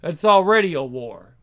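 ordinary talk, nothing marked

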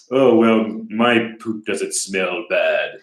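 very slow, affected snooty drawl; mock superior airs, stretching the words out; uppity. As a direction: put on a self-satisfied uppity voice. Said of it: "we're gonna be uppity about it".

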